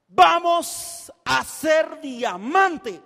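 grito lento y decidido, más fuerte; anúncialo a la esposa con todo; decisión gritada. Retold or imitated. (a la esposa, gritando decidido: vamos a hacer diamante)

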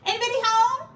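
high, loud cheery call; Ratty calling into Mouse's house. Acted. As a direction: shout it through the hole in the wall. Ratty calls through wall.

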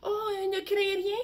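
a tiny mouse calming a giant; little mouse reassuring. Acted. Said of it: "dit la petite souris".

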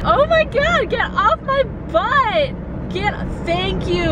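high, slow, exasperated plea at the tailgater; real high exasperated cry at a tailgater; exasperated cry at driver. Real, not acted. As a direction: squeak it out in disbelief at the car behind. Tailgater behind her car.

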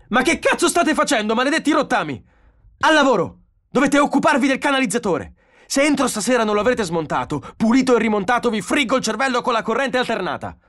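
high, loud enraged screaming; furious yelling. A narrator's reading. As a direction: bawl out orders and threats. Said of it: "Donovan strillò".

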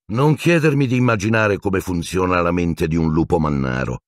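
ordinary delivery

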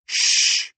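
sharp whispered shush. Acted. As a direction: hush them with a hiss. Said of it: "Sadie put her finger over her lips".

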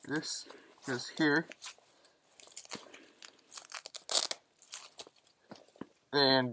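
muffled behind mask voicemitter; speaking inside sealed respirator; through a gas mask. Real, not acted. Seen 1: Full gas mask sealed over face while speaking throughout.

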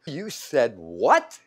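loud, drawn-out incredulous question; mock shock. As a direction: stretch it slowly in hammed-up disbelief. Retold shocked reaction to her swearing.

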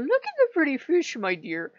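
high, sweet coaxing grandpa tone; grandfatherly sweet old man. Retold or imitated. Odin showing her the fish, sweet grandfatherly voice. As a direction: coo it like a kind grandfather. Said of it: "grandfatherly, sweet old man voice".

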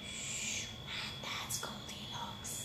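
soft shush, then whispered reveal; hushed whisper. A narrator's reading. Narrator revealing sleeping Goldilocks to children, whispering. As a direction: whisper so as not to wake her.

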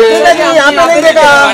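loud, high shout across the crowd; calling out. Real, not acted. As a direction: shout to catch her eye. Paparazzi calling actress for photo.

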